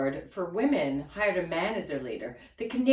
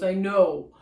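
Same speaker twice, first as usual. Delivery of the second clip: stranger quote; 5 dB louder, slower than usual. Retold or imitated. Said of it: "And he goes".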